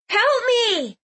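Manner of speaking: high-pitched plea for help; cry for help. Acted. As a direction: call out for rescue in a high voice. Trapped girl calls from tower.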